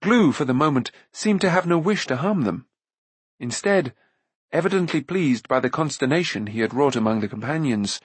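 ordinary delivery, nothing marked

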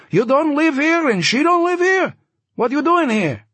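put-on high, loud voice, light Yiddish accent; slight Yiddish accent. A narrator's reading. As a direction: press him in a high Yiddish-tinged voice. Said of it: "slightly Yiddish accent".

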